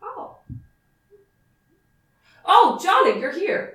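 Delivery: high, breathy-surprised slip into the wrong character; accidentally using Johnny's voice for someone else; wrong character voice by mistake; mistaken Johnny voice. Acted. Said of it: "I said that in the Johnny voice".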